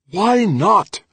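loud, very high demand; furious demand. A narrator's reading. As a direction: demand an answer, temper blazing. Trudy's angry demand in quarrel.

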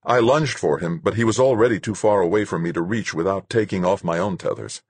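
ordinary delivery

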